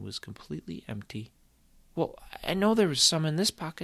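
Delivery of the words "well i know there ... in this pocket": high, louder puzzled protest; insistent boy. Acted. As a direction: sure the gold was there.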